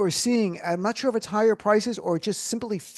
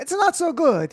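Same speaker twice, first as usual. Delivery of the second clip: "It's not so good" in a high, hammed-up Italian accent; 10 semitones higher, 3 dB louder, slower than usual. Shown as a demonstration. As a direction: play a bouncy Italian waiter saying "It's not so good". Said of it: "said with an Italian accent".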